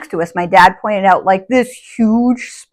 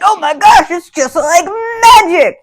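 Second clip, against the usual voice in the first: put-on higher, slow, teary little-girl wonder; amazed child; 9 semitones higher, 4 dB louder, slower than usual. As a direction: gasp it out like a kid seeing magic. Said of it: "But I was like".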